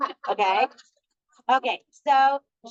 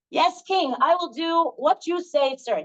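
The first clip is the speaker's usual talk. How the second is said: raised, slow, humble servant voice answering the king; bow and promise slowly, pitched up; obedient servant; 7 semitones higher, slower than usual. Acted.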